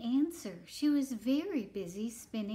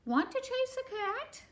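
very high, eager question; squeaky little dog voice; storybook dog; 3 dB louder than usual. Acted. Dog invites the spider to chase, high eager voice. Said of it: "barked the dog".

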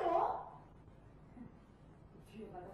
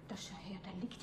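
whisper; 9 semitones lower, 13 dB quieter, faster than usual. Acted. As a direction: sly whisper to herself. Thief spots the watch.

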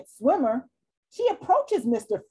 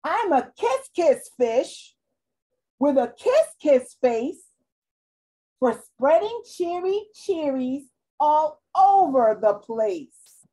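higher, brighter overjoyed fish; delighted fish; 4 semitones higher, 4 dB louder than usual. Acted. Kissed fish tells his friends, high and joyful. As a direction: sing out a new happy self. Fish cheered after kiss.

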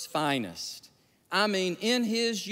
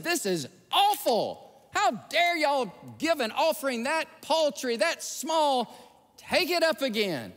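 higher-pitched, indignant mock scolding; scolding pastor; 7 semitones higher than usual. Pastor scolds congregation over a small offering, indignant. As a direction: act out an outraged pastor berating the flock. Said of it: "he just scolded them".

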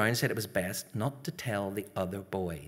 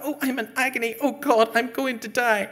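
hammed-up dying wail, loud and high; mock agony; 7 dB louder than usual. Schoolboy faking agony to get out, hammed-up wail. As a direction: overplay the pain for the teacher. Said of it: "I'm only acting".

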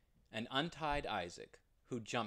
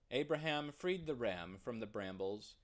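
ordinary delivery, nothing marked

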